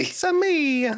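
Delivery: high cartoon Italian accent; squeaky Italian plumber catchphrase; Mario impression.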